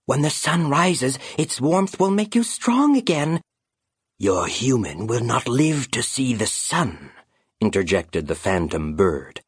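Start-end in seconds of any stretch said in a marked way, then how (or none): 0.1-3.4 high, gentle raven voice, hopeful; a small bird trying to comfort, pitched high; loyal young raven. Acted. Raven Concord soothing his dying knight, high and gentle. 4.2-7.1 eerie, cold phantom voice, coaxing; a ghostly bird tempting its prey; sinister phantom bird. Acted. Phantom bird tempting the grieving raven, eerie and cold.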